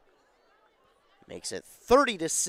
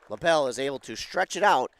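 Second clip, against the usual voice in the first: usual speaking voice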